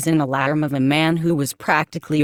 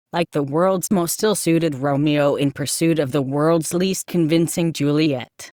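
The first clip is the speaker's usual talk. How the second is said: ordinary delivery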